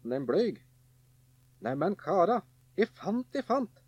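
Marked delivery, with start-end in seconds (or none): 1.7-3.8 high eager calling out; excited call. A narrator's reading. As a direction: call the brothers over with glee. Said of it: "ropte han".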